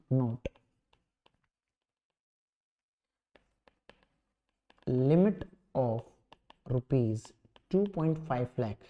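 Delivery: low, spaced-out note dictation; slow phrases with long writing pauses. Real, not acted.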